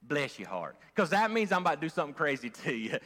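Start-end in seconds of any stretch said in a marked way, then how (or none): 0.0-0.8 a lightly put-on Southern drawl on "Bless your heart", pitched low; a hint of a sugary Southern lady saying "Bless your heart". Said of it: "I ain't talking about this Southern".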